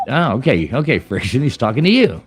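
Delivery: ordinary talk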